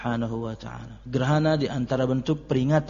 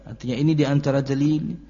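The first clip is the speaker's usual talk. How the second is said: ordinary talk, nothing marked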